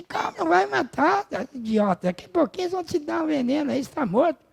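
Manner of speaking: high-pitched, scolding imitation of a wife; imitated wifely outburst, voice way up high; exasperated wife. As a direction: squeal it as a mock-frantic wife. Wife protesting to calm Socrates.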